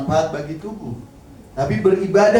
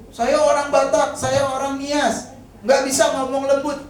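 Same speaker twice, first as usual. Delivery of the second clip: mock excuse; 11 semitones higher, 3 dB louder than usual. Mocking a congregant's excuse.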